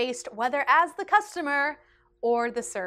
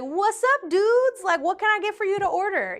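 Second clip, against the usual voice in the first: higher and louder, a mock laid-back server voice; cool server; 9 semitones higher, 6 dB louder than usual. Server greeting two teenage girls, mock cool dude voice. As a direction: play the too-cool teen server greeting customers. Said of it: "'cause I'm a cool server".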